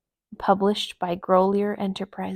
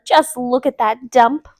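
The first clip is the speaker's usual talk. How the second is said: disgusted; 3 semitones higher, 9 dB louder than usual. A narrator's reading. Delilah disgusted.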